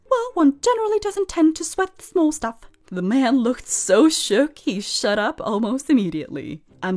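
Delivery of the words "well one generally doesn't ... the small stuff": a hint of a posh Glaswegian accent, pitched higher; lightly put-on posh Glaswegian accent, clipped. Telling off a complaining old man, posh Glaswegian accent. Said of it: "in my best clipped northern Glaswegian posh boy accent".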